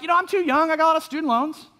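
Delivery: high-pitched mock protest; whiny excuse. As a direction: imitate a young person begging off. Imitated young people making excuses.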